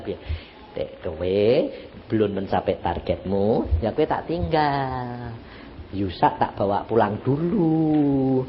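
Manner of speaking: low, slow, soft pronouncement; calm voice of God. Retold or imitated. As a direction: speak gently and unhurriedly as God to the wife. Voices God's calm offer to wife.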